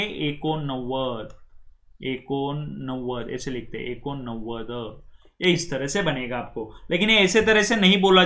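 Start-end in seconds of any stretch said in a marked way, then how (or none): 0.0-5.0 एकोनव्वद drawn out slowly and softly, syllable by syllable; slow soft spelling-out of एकोनव्वद; Marathi number. Shown as a demonstration. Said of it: "और क्या आएगा आगे?".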